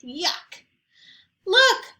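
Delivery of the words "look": loud, high alarmed cry. A narrator's reading. Zoe crying out to family about the candles, loud high alarm. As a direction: burst out in alarm. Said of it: "cried Zoe".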